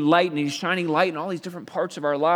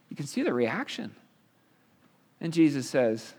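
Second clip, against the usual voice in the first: ordinary delivery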